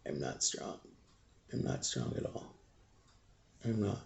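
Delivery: near-voiceless grief; barely getting words out; real hushed grief; choked quiet. Real, not acted. Grieving, voice collapses.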